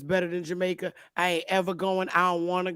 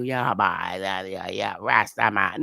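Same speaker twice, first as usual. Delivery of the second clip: lower, lightly imitated Jamaican patter; mock Jamaican; 11 semitones lower, slower than usual. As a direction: mimic a Rasta talking, mocking. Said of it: "start telling you".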